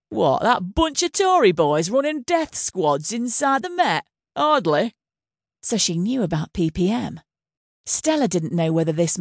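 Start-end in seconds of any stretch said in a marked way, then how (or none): 0.1-5.0 a lightly voiced northern English politician, scoffing with a smile; amused scorn. A narrator's reading. Home Secretary mocking the death-squad rumour, amused northern English scorn. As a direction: sneer at the idea, smiling. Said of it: "She smiled".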